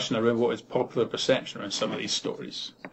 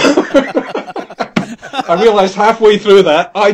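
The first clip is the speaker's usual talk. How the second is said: laughing; 7 semitones higher, 8 dB louder, much slower than usual. Real, not acted. Laughing at failed accent.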